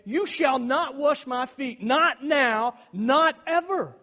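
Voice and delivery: slow, loud, rising insistence; loud, slow, emphatic no; emphatic refusal. Retold or imitated. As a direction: hammer each not as Peter refusing. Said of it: "So Peter's basically saying".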